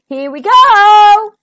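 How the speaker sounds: loud, high, long-drawn launch cry; whooping storybook shout. A narrator's reading. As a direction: stretch it out as you dive. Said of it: "it cried".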